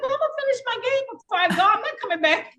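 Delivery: high, fast, laughing brush-off; cocky brush-off. Retold or imitated. Teen tells staff she'll finish her pool game first, cocky and laughing. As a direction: toss it off like you own the place.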